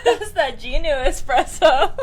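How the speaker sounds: laughing. Real, not acted. Joking about Ginu espresso.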